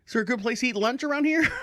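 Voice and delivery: fast, laughing through it; high laughing question after missing the appointment. Real, not acted. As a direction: laugh at yourself. Laughing at his mistake.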